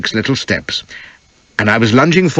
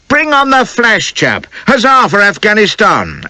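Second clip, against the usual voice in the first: crowd cheering; 11 semitones higher than usual. A narrator's reading. Townies calling for the flash bowler, cheering. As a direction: cheer him onto the field like a townie. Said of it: "began to cry".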